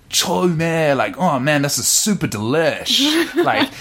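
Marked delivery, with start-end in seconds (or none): 0.1-0.9 higher-pitched mock youth exclamation; youth slang. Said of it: "like, youth word". 1.2-3.0 higher-pitched gushing slang; youth slang. Acted. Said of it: "like, youth word".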